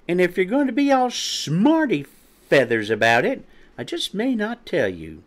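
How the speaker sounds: high, huffy little threat; indignant. A narrator's reading. Quinny threatening to withhold her plan, indignant. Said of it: "in an expression of severe indignation".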